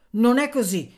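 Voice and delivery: secca smentita, alta e forte; irritato. A narrator's reading. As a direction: ribatti con irritazione, alzando la voce. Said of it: "disse Anania irritato". (irritato, a voce alta)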